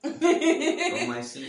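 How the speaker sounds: slow, laughing joke; husband teasing through laughter. Real, not acted. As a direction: chuckle the words out.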